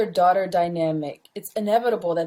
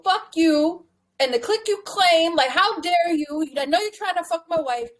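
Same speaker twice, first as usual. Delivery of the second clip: higher-pitched, indignant mock tirade; retold angry callout; 7 semitones higher than usual. Dead man's letter confronting his best friend, mock indignation. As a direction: tell someone off, acted out.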